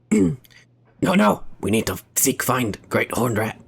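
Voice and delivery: low, hissing rat-man voice; a mild hissed Skaven rat voice. Shown as a demonstration. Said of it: "the fucking Skaven voice".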